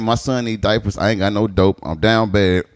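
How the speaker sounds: ordinary delivery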